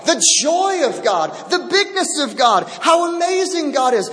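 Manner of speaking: full-voiced sermon climax; fervent preaching. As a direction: preach it up with growing fire.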